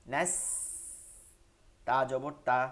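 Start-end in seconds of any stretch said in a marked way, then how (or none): 0.1-0.3 নাস ending in a long, very thin s; razor-thin s closing নাস; thin seen. Shown as a demonstration. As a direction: stretch the fine whistling s of নাস. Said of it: "একদম চিকন".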